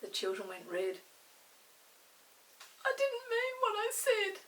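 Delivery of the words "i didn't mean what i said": put-on small, high girl's voice, a touch contrite; little voice. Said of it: "said Molly in a little voice".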